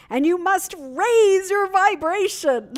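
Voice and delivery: high, loud, slow preacher voice; preaching boldly; Michael Beckwith. Retold or imitated. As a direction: proclaim like a TV preacher. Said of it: "Michael Beckwith is saying".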